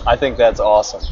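usual speaking voice

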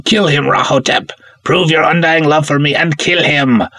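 villainous princess shouting. Acted. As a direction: egg the monster on, high and fierce.

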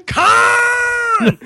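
long loud anguished Khan scream; Khan yell. Pretend. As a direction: yell it like Kirk, stretched out. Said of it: "that's really funny".

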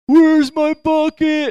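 put-on high, slow, whiny kid asking for his bucket; a child whining from the water. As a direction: play a lost little kid, drawn out.

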